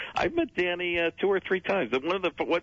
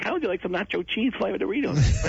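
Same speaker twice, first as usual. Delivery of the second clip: Jay Leno impression; 9 semitones higher than usual. First Leno try in the mirror, chin out. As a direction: push the chin out and pitch it up. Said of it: "jutted my chin out and was like".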